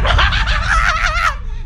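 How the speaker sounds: high shrieking burst of laughter; loud laughter. Real, not acted. Reacting to a dog imitation. (malakas na halakhak)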